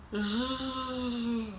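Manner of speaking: a long buzzing zh sound. Shown as a demonstration. Teacher demonstrates zh to learners, long buzzing zh. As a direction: hold the Russian zh like a buzz. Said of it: "поговорим о звуке «ж»".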